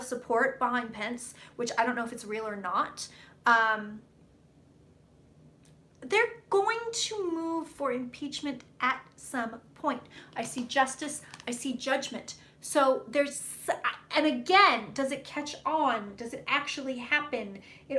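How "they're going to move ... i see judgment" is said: slow, hushed prophetic tone; psychic reading voice. Acted. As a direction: intone it like a seer.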